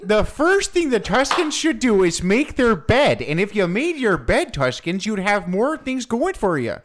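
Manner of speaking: high, swooping Canadian lecturing voice; Jordan Peterson. Acted. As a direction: lecture them sternly in a mock Canadian accent. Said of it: "Sounds like Jordan Peterson".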